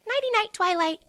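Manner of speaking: high, sing-song goodnight; high giggly cheer; playful Pinkie. A narrator's reading. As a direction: tease out the rhyme. Pinkie voiced.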